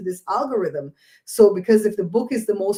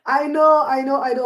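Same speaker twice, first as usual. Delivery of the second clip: excited I know; 5 semitones higher, 5 dB louder than usual. Real, not acted. Excited agreement.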